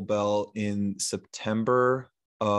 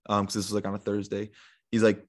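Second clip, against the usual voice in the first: usual speaking voice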